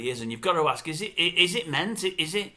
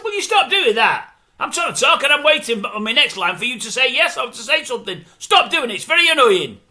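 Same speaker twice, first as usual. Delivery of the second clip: high, loud mock scolding; irritated telling-off; 6 semitones higher, 5 dB louder than usual. Presenter telling off co-host who keeps saying yeah, loud irritation. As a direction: act out snapping at a chattering co-host.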